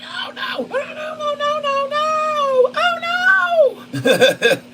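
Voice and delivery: very high panicked squealing, breaking into laughs; terrified worm. Pretend. Trader voicing the worm in panic, very high laughing shrieks. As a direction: shriek like a tiny creature about to be eaten. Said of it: "He's like".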